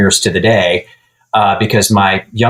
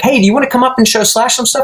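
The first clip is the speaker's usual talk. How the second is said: mock friend voice; faster than usual. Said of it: "He's like".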